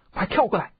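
high, urgent warning cry; urgent narrated shout to jump; alarmed shout. A narrator's reading. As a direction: call out in alarm, voice jumping up. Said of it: "张翠山叫道".

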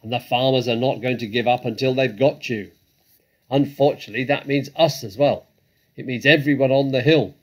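loud, angry badger voice; booming, indignant tirade; furious. A narrator's reading. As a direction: rail at the fox at full volume. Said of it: "said the Badger furiously".

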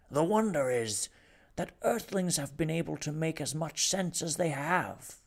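a lightly voiced dignified Rumford; measured farewell speech.

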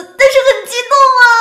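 high, fast excited burst; thrilled. Acted. A passer-by admits the sighting thrilled her, fast and high. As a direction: blurt it out, barely containing it.

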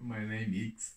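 low, soft go at a German accent; low-voiced German accent attempt. Shown as a demonstration.

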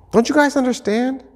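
high-pitched, urgent plea; pleading. Acted. Jesus pleading with the disciples in the boat, high and imploring. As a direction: beg them to get it, voice pushed high. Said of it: "pleading with them".

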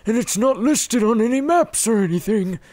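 put-on high, slow old man voice; creaky old adventurer reading his diary; old traveler voice. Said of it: "when we adopted that voice".

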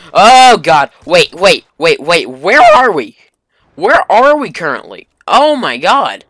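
loud high startled cry; suddenly realizing something's wrong; sudden realization. Real, not acted.

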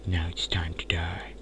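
soft, mock-sinister whisper; menacing whisper. Gamer threatening a creeper, mock-menacing whisper.

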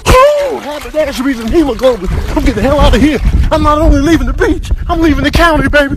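high, loud panicked yelling, rushed; panicked shriek. Acted. Fleeing the beach in a panic, high frantic yelling. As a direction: screech and bolt in panic.

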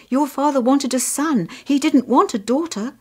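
high, loud, anxious mother; distressed mother's urgent protest; crying out. A narrator's reading. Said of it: "his mother cried".

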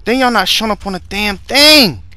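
loud complaint climbing high; outraged disbelief, pitch shooting up; exasperated yell. Real, not acted. Player when nothing shows up, exasperated yell.

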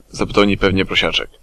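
usual speaking voice

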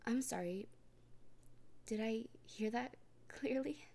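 normal talking voice

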